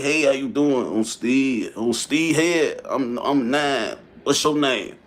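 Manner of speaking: a hint of an old man spitting game; granddaddy voice. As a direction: drag out the pickup line like a slow old granddaddy. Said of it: "like, his granddaddy voice".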